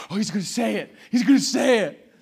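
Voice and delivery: mock excitement rising to loud; excited crowd, building. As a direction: build from hopeful to loud thrill. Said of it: "the Christians are like".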